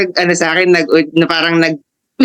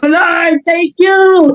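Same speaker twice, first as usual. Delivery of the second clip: joyful shout; 12 semitones higher, much slower than usual. Retold or imitated. Thanking the Lord after reading the verse, high joyful shout. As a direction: shout it up to heaven in relief. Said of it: "sumisigaw po ako mag-isa".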